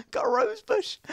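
put-on very high, girly squeal of delight. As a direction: coo it like a giddy little girl. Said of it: "I'm such a girl".

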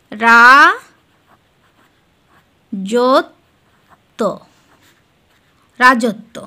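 রা, জ, ত said apart with long pauses, then রাজত্ব; slow spelled-out syllables. Shown as a demonstration. Teacher to students, sounding out রাজত্ব syllable by syllable. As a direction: break রাজত্ব into its sounds, then join them. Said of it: "এখানে কি হচ্ছে উচ্চারণটা?".

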